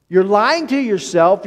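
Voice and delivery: normal talking voice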